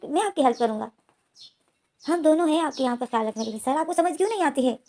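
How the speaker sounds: plead, frustrated he won't understand; exasperated pleading. Acted.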